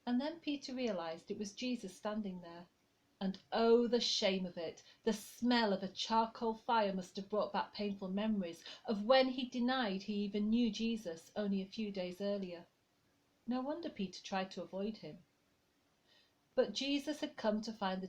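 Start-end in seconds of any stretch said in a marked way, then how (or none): none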